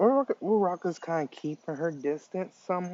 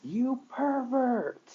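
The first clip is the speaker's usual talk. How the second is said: slow, high-pitched scream of outrage; 11 semitones higher, much slower than usual. A narrator's reading. Uraraka slaps Izuku for kissing her, screaming. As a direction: shriek it out, dragging the word.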